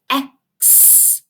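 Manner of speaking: "X" as a short eh, then a drawn-out ks; "X" split into its sounds, eh and ks; syllable sounded out. Shown as a demonstration. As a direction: say "X" as the opening syllable, eh-ksss. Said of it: "let's tap out each syllable separately".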